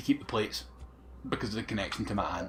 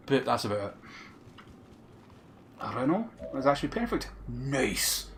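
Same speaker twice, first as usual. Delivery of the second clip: normal talking voice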